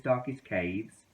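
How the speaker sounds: ordinary delivery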